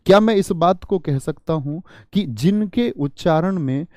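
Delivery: ordinary talk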